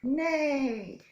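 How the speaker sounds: high loud neigh; whinny for the kids; horse neigh. Pretend. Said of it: "Can you be a horse?".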